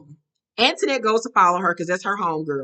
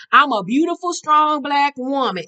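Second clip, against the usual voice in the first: affirmation; 6 semitones higher, 5 dB louder than usual. Retold or imitated. Group member leading the women in an affirmation, proclaimed high and loud. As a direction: proclaim it proudly, stretching the last words. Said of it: "she starts to do the affirmation".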